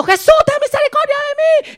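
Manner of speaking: very high, loud pleading shout; desperate cry. Acted. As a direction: call out over the crowd. Blind man crying out again.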